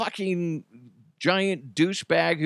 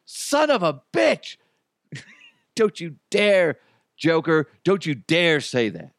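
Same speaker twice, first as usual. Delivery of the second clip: higher pitched hammed-up fury at a friend; mock outrage; 5 semitones higher than usual. Host scolding his friend over the [name] prediction, hammed-up mock outrage. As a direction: play up the indignation, half laughing.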